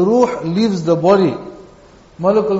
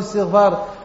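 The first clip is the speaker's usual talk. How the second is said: ordinary delivery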